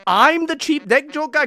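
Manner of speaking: high, loud incredulous question; indignant disbelief. Acted. Streamer answering the cheap joke accusation, indignant disbelief. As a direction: throw the accusation back, voice jumping up.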